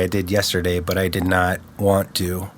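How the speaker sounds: ordinary talk, nothing marked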